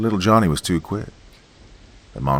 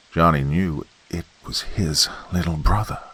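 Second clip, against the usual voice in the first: ordinary delivery, nothing marked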